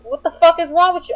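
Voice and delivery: high, loud, outraged challenge; worked-up retold outburst, pitched high; retold going crazy. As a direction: relive cursing him out. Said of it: "I just went crazy on this guy".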